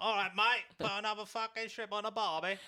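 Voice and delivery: broad, loud mock Aussie twang; high-pitched hammed-up Australian accent. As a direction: put on a terrible Australian accent. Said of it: "And then the accent, I couldn't deal with that accent".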